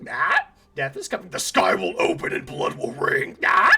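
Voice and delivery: creature voice. Acted. Parrot creature cries omens.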